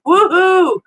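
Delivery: loud high whoop of delight; excited cheer. Real, not acted. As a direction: whoop it out like a fan. Cheering a viewer's comment.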